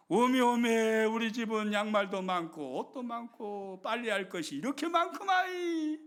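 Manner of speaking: delighted thanks. Acted. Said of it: "thankful homemaker voice".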